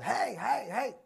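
high, repeated herding calls; shepherd's yell. Acted. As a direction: yell out as if calling animals. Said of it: "the shepherd would have just yelled".

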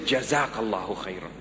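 low, sincere thanks; heartfelt. Shown as a demonstration. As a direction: say it from the bottom of your heart. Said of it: "mean it from the very bottom of your heart".

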